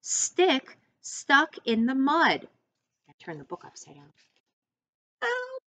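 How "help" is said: help squeaked very high; a tiny high call for help; cry for help. Acted. Stick stuck in the mud, a very high cry for help.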